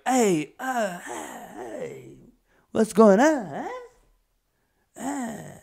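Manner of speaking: high, drawn-out mock catcall; ogling old Italian men. Old Italian men when someone hot walks in, mock leering. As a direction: leer like an old Italian man, hammed-up. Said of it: "if someone hot walks in, they'll be like".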